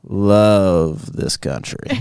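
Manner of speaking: drawn-out Southern drawl, words carried long; hammed-up slow Southern patriot; Southern twang. Shown as a demonstration. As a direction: stretch each word in a Southern accent. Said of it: "You gotta carry some words".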